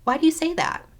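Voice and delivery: light, high, respectful asking; respectful question. Shown as a demonstration. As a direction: model a polite curious question. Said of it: "Ask them respectfully".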